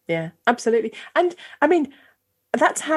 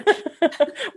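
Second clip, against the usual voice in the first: light high giggle; 8 semitones higher than usual. Real, not acted. Host laughs again.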